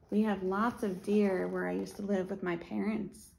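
normal talking voice

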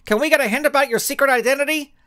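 high, fast, pushy question; eager reporter. A narrator's reading. A reporter hurrying to ask Suprema a question before she leaves.

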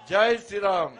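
everyone shouting the slogan together; full crowd shout. Real, not acted. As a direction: roar it all together. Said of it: "అందరం కలిసి అందాం". (అందరూ కలిసి గట్టిగా అరిచే నినాదం)

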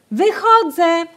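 high, loud hopeful call; hopeful shout. A narrator's reading. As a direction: shout out hoping someone hears. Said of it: "krzyknęła z nadzieją".

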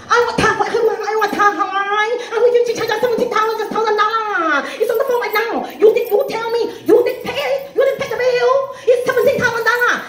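put-on very high, wailing Vietnamese-accented tirade; Vietnamese accent, arguing. Kim arguing with husband over the bill, Vietnamese accent. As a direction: argue shrilly high in a mock Vietnamese accent, dragging phrase ends. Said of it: "Vietnamese people arguing".

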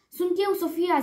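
quick, urgent reassurance of who it is; said quickly. A narrator's reading. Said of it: "zise ea repede". (spus repede)

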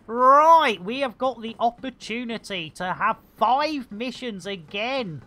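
ordinary delivery

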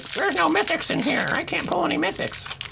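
high mocking whine of his own complaint; mock whiny complaint. Opener mocking his own griping about no mythics. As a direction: parody yourself griping. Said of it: "As I'm bashing the set, like".

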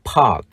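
"park" with long ah and final k; model pronunciation. Shown as a demonstration. Teacher shows how to read park, "park" as long ah plus k. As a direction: say "park" without a heard r, releasing the k. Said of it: "chúng ta đọc là".

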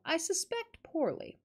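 ordinary delivery, nothing marked

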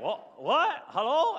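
high, loud confused "What? Hello?"; squeaky bewildered phone voice; confused caller. Retold or imitated. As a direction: play the baffled caller on the line. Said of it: "they're like".

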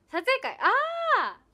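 slow, high, loud 「あー！」; excited cry. Real, not acted. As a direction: cry out in delight. Reacting to a fan comment. (嬉しそうに「あー！」と叫ぶ)

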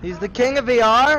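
he's the king of what?; surprised question. Real, not acted. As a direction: player realising the victim was the King of VR, rising in shock. Learning who she killed.

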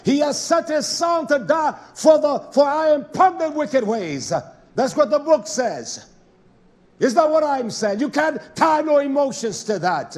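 loud, high pulpit delivery; forceful preaching. Real, not acted. Preacher defending what the book says, forceful preaching. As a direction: drive each point home at full voice.

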